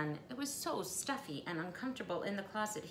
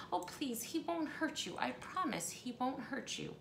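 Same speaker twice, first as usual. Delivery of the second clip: soft, higher pleading reassurance; reassuring; 5 semitones higher, 5 dB quieter than usual. Acted. Missus Prim calming her frightened guest, soft reassurance. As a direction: soothe her gently. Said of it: "Missus Prim tried to reassure her".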